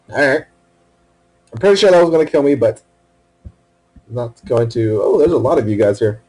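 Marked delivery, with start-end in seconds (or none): none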